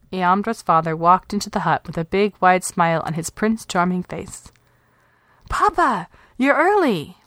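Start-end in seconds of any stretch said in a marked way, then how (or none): 5.5-7.2 put-on high, glad girl's greeting; a girl thrilled Papa is home; young girl, higher and joyful; delighted child. A narrator's reading. Child greeting father happily.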